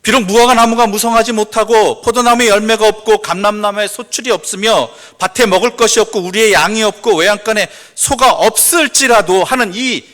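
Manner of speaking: loud, high scripture declaration; full-force preaching. Real, not acted. Preacher reciting the barren fig tree verse, full-force preaching. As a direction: proclaim the verse across the hall.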